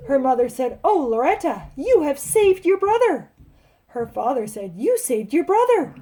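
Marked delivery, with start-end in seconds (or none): 0.8-3.3 high, loud storybook gushing; overjoyed mother. A narrator's reading. Mother greets Loretta after hospital, high gushing praise. 4.8-5.9 high, loud storybook praise; delighted father. A narrator's reading. Father echoes mother's praise of Loretta, high delighted exclaiming.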